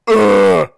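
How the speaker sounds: sharp loud groan of disgust. Real, not acted. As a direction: let out a loud fed-up groan. Disgust at hours-long interview.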